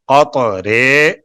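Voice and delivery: "قطرے" very slowly as qat-RAY; "قطرے" sounded out with a long ay; model vowel. Shown as a demonstration. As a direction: draw out the final ay of "قطرے". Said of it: "قطرے پڑھا جاتا ہے".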